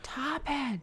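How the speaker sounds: put-on high, small toddler voice; imitating toddler daughter. Two-year-old refusing her parents, sassy toddler imitation. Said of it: "comes out of her a lot".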